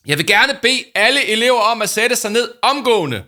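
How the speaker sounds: high, loud, grave command; booming serious announcement; serious shout. Acted. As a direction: order the whole school to sit, loudly. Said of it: "råbte Paul med en alvorlig stemme".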